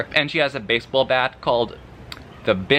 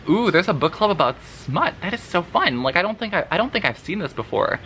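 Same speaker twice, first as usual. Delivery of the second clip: higher-pitched, gushing mock excitement; delighted reaction; 6 semitones higher than usual. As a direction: gush as if discovering something fun. Retelling her own delighted reaction.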